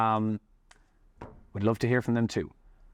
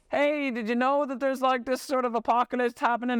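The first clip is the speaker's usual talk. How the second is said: high, excited mock warning; high mock alarm about an apocalypse; mock alarmed neighbor; 12 semitones higher than usual. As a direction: play a wide-eyed neighbor. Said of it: "it's like".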